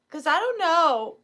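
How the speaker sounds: ordinary delivery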